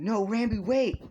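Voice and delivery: shouted high and loud after someone leaving; desperate shout. Acted. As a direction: yell it as he disappears, begging him to stop. Izuku calls after vanishing Ranbu.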